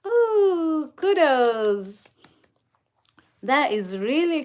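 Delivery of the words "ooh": ordinary talk, nothing marked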